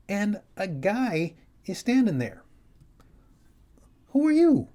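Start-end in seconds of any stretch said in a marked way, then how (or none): 4.1-4.7 high, loud startled cry; startled shout. Retold or imitated. He shouts at the stranger in his hallway, startled. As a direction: blurt it out at the intruder.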